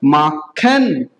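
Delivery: माखन low and slow, as "maa-khen"; German machen. Shown as a demonstration. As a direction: split माखन into two drawn-out syllables. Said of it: "क्या बनेगा".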